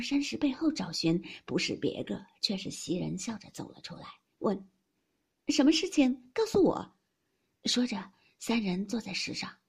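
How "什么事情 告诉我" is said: high, loud, curious demand; lively nosy asking; eager questioning. A narrator's reading. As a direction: press eagerly to be told. Newcomer eagerly asks to be told.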